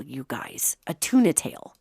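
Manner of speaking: whisper. Real, not acted. Confiding aside about tuna.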